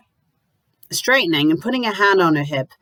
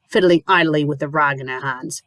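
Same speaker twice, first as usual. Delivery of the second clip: ordinary delivery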